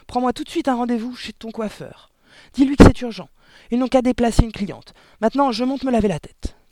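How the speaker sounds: high, loud, hurried husband; urgent orders. Acted. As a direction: order your wife about in a flap. Father barks urgent orders to wife. (ordres urgents, voix forte et aiguë)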